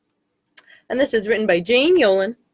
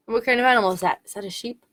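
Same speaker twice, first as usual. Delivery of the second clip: plain reading voice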